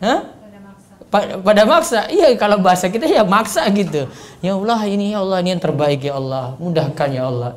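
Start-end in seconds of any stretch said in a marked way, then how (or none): 4.4-7.5 low, soft but pressing plea; firm insistent prayer. Shown as a demonstration. Ustaz showing a firm prayer, low and pressing. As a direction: press the request earnestly. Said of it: "Sudah, tegas itu".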